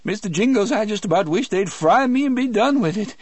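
high, loud shaky Cajun voice; frightened Cajun. A narrator's reading. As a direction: despair to his mouse, high-pitched. Delacroix continues frightened.

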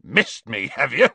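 scornful, snorting retort; snorted. A narrator's reading. Hagrid doubting Hermione, scornful snort. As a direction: snort it in disbelief. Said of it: "snorted Hagrid".

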